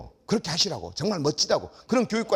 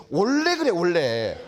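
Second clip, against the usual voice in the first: little daughter; 4 dB louder, much slower than usual. Retold or imitated. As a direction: ask it like a puzzled little kid. Young daughter asking about boys.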